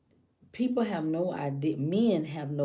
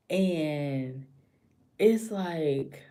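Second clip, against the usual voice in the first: normal talking voice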